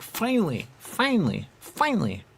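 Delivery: relieved "finally". Retold or imitated. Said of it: "You're like".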